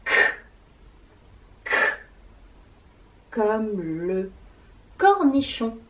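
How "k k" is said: the sound k said alone, short and unvoiced; isolated k sound. Shown as a demonstration. Teacher isolating the k sound for the class. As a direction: say just the k sound, no vowel.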